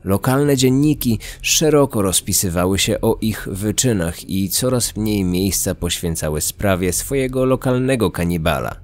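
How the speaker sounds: plain reading voice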